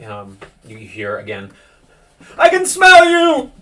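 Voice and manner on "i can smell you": high, loud, drawn-out yell; eerie shouted threat. Acted. Troubled son calling from down below, eerie shout. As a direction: shout it long and high, far above normal talk. Sudden cry heard from below.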